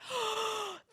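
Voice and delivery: high, sudden intake of breath; amazed gasp. Retold or imitated. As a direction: gasp as if it suddenly dawns on you. Sudden realization that it worked.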